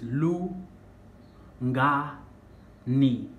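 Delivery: Lu, Nga, Ni split into three separate beats; syllable by syllable. Shown as a demonstration. Teacher splitting Lungani into syllables for learners, clearly and deliberately. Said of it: "three syllables".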